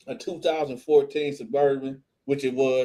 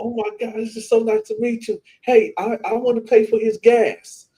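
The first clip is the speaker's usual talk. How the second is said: high, excited and getting louder; gushing fan; 8 semitones higher, 4 dB louder than usual. Retold or imitated. Lady offering to pay for his gas, excited. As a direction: imitate a gushing admirer. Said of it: "And she was like".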